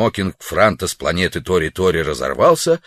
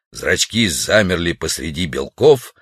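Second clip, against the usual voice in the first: plain reading voice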